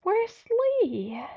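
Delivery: high, strained voice through pain; pained gasp. A narrator's reading. Narrator pushes out the hurt question, high and strained. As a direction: squeeze it out, hurting. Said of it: "eyes stinging with pain".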